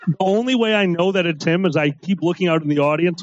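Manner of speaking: ordinary delivery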